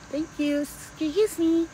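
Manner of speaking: high, slow, drawn-out EXCUSE me; sassy excuse me. Shown as a demonstration. Pushing away bad energy, sassy drawn-out excuse me. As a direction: brush the energy off with mock politeness. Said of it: "I'll push the energy".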